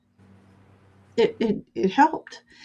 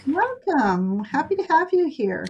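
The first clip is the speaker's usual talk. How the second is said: excited greeting; 6 semitones higher, 3 dB louder than usual. Real, not acted. Greeting a viewer on stream.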